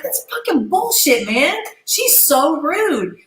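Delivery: high, drawn-out retold outrage; indignant protest. Pulled apart from her rival, indignant. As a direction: stretch out the aggrieved complaint.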